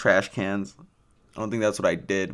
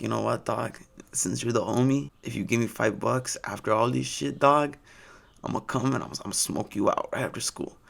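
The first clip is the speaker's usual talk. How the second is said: Chris; 5 dB quieter than usual. Retold or imitated. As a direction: coax him in a stoner homie accent. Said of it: "I'm not just doing this accent".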